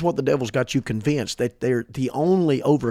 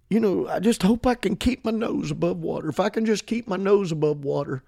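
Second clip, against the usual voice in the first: higher-pitched imitation of someone barely coping; mock defeatist; 5 semitones higher than usual. Preacher mimicking the attitude of struggling believers, mock defeatist. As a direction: plead feebly, as a parody. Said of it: "that attitude".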